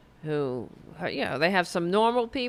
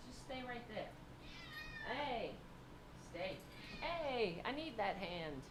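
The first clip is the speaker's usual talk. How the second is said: very quiet firm stay; barely voiced, keep the dog still; hushed command; 3 semitones higher, 20 dB quieter than usual. Real, not acted. To the dog on the couch, whispered command.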